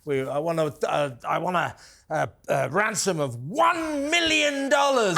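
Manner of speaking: grand ransom demand, swelling at the end; Austin Powers villain. Retold or imitated. Film character thawed after fifty years demands ransom, pompous villain voice. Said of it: "Austin Powers, you know, when he's been frozen".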